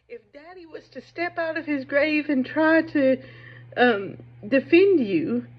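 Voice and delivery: mocking Heathcliff. Retelling Heathcliff taunting the son.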